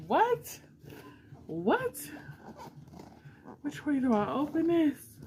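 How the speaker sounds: high, disbelieving cries of what; real shock breaking the voice high; stunned. Real, not acted. Opening a fan's gift box, squealing in disbelief.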